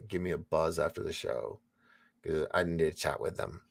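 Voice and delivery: normal talking voice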